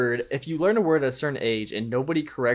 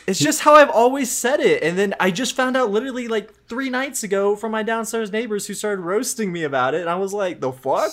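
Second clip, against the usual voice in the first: flustered defense; 9 semitones higher, 4 dB louder than usual. Real, not acted. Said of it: "defending his pronunciation".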